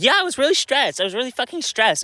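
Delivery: high mocking whine imitating her; mocking sister. Mocking his sister's excuse in a high whiny voice. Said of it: "And she was like".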